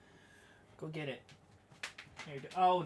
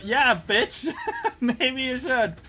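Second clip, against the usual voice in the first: raised, laughing outburst at someone's folly; loud incredulous scolding; 5 semitones higher, 8 dB louder than usual. Real, not acted. Streamer telling off people in a tornado clip, loud and incredulous. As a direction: yell it, incredulous, laughing at first.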